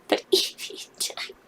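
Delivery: slow, breathless whisper with a laugh. Real, not acted. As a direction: whisper the bad news in disbelief. Another favorite died.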